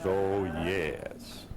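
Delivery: normal talking voice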